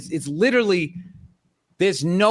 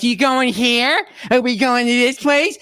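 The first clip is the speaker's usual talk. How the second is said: wife's eager questions, higher and slowed right down; mimicking wife; 4 semitones higher than usual. Husband mocks wife quizzing him on the drive. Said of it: "she's like".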